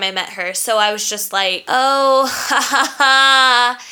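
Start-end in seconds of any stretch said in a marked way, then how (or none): 1.7-3.8 fake nice-girl laugh. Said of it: "my classic fake-ass nice girl laugh".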